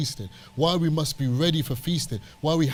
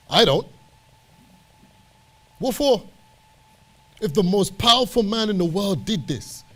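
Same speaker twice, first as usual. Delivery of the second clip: impassioned preaching; 5 semitones higher than usual. Real, not acted. Preacher holding Moses up to the congregation, impassioned. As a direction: preach it with mounting conviction.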